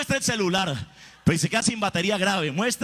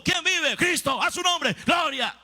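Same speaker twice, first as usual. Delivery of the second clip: higher rapid call and reply; a drill call-and-response, higher; 7 semitones higher than usual. Retold or imitated. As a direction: play both officer and troops. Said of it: "Ahora vamos a hacerlo en combo".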